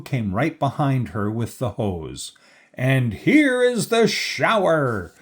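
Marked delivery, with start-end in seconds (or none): 2.7-5.1 high, drawn-out triumphant announcement; announcing shout. A narrator's reading. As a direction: present it with a slow, raised-pitch flourish. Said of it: "he shouted".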